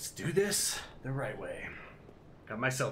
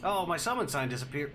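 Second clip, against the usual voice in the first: normal talking voice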